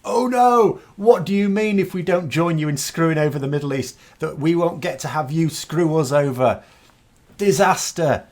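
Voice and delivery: high-pitched mock dismay; sarcastic. Commentator answering a trade threat, sarcastic. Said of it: "would be to sarcastically say".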